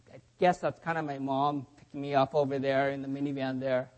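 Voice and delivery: low, soft, reluctant embarrassed mumble; embarrassed kid. Acted. Embarrassed kid at school pointing out mom's minivan, sheepish. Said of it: "they might be kind of embarrassed".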